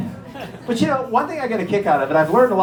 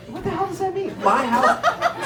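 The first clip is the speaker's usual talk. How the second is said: baffled laughing squeak; 11 semitones higher than usual. Acted. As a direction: squeal your confusion. Baffled by lyric.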